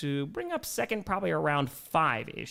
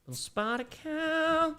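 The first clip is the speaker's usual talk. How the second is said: much higher, slow silly voice; 12 semitones higher, much slower than usual. Pretend. Showing chat the beer, slow goofy high voice.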